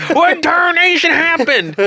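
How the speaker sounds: high, slow, hammed-up geezer holler; mock old-timer outrage. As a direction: squawk like a baffled old-timer. Joins the mock old-timer rant.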